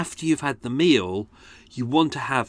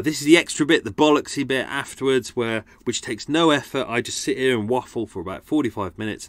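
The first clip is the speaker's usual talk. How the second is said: normal talking voice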